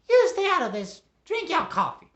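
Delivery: high, loud nagging caricature; Missus Wiggles, scolding. Acted. Wife tells husband to stay out, scolding squawk. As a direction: squawk orders at a meddling husband.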